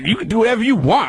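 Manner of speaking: high-pitched mock clerk; imitating defiant rep. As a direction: wave the caller off in the rep's high voice.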